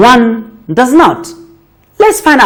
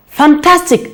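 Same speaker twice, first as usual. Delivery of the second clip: higher bright praise; teacher praise; 6 semitones higher than usual. Real, not acted. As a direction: warm encouraging praise. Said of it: "praising child".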